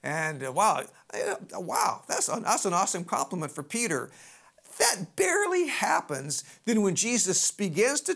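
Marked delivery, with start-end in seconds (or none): none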